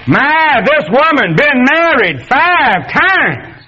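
high, slow, hammed-up prig voice; mock self-righteous man. Preacher mocking the righteous man to the congregation, hammed-up high prig voice. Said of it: "I might have said".

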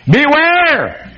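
Beware! called out high, as on a warning sign; exclaimed warning. Shown as a demonstration. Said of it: "Do you need an exclamation point?".

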